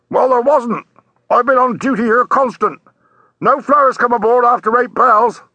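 high-pitched, emphatic sailor voice; ship's sailor. Acted. Sailor answering a policeman from the deck, emphatic. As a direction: call down firmly from up at the rail.

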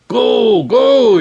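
high, loud urging command; urgent shout. A narrator's reading. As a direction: push them up the ladder now. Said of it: "Ilyas ordered".

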